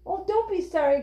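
high, quick-to-reassure exclamation; storybook character crying out cheerfully; earnest cry. A narrator's reading. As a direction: brush the apology away in a lifted voice. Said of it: "cried Jessie".

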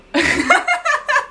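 high squeal of laughter. Real, not acted. Laughing at own mistake.

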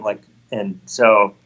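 usual speaking voice

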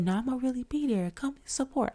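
imitated soft, high R and B dude voice; John B. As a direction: reassure softly in a lifted singer's voice. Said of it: "He talk like an R and B dude".

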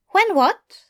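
high, curious Aziraphale voice; gently probing question, voiced high; Aziraphale asking. A narrator's reading.

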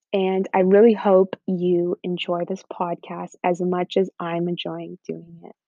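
normal talking voice

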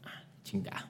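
soft unvoiced curse under the breath; whispered curse. After the refusal on the phone, a whispered curse. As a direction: swear it away under your breath.

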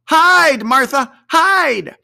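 very high, loud urgent hiss to hide; children shouting hide in glee; urgent children's cry. A narrator's reading. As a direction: kids frantically hurrying their sister. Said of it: "cried the two young Cratchits".

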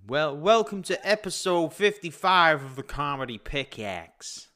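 slow, deliberate mock-American show intro; imitated American accent. British host copying co-host's show intro, put-on American accent. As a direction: copy an American podcast host's accent, drawing it out. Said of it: "Try to say it like I say it".

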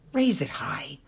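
breathed encouragement, hushed; soft whispered urging. Acted. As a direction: whisper it as a gentle command. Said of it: "I heard him say".

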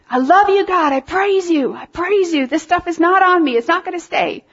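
high-pitched, fervent shouted praise; re-enacted praise shout. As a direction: cry out praise to God, pitched well up. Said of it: "I'm just shouting".

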